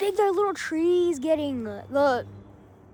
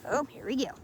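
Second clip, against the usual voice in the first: usual speaking voice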